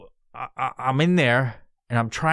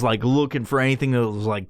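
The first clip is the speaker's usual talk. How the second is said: ordinary talk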